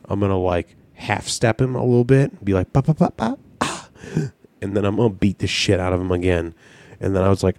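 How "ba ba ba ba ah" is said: mock dodge noises. Imitating dodge sounds in game.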